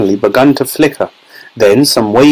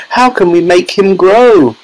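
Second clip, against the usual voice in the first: high, pleading wail; 8 semitones higher than usual. A narrator's reading. Mother begs Wonka for help, high wail. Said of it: "wailed Missus Teavee".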